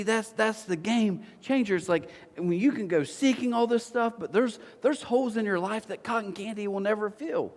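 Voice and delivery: ordinary delivery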